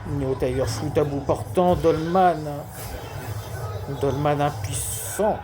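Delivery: lively sports commentator calling a match; play-by-play commentary. Acted. Live football game commentary. (commentaire sportif en direct)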